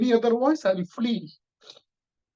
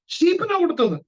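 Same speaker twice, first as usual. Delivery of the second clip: sudden much higher and louder; excited answer; 14 semitones higher, 3 dB louder, faster than usual. Acted. Preacher answers his own question, much higher and louder. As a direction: burst out with the answer.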